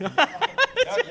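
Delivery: laughing through a mouthful of drink; laughing with mouth full. Real, not acted. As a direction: laugh with liquid held in mouth. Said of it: "laugh with liquid in my mouth".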